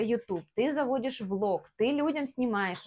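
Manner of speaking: normal talking voice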